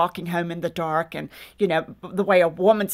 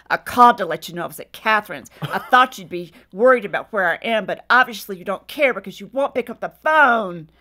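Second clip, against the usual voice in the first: louder, accusing voicemail, replayed; caustic; 6 dB louder than usual. Wife's angry message on the home phone, caustic. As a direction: imitate a huffy scolding message. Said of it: "And then it switched to this".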